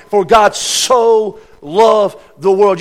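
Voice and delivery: "For God so loved the world." stretched out very slowly; slow recitation. Acted. Preacher savouring John three sixteen, very slow. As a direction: lay each word down slowly.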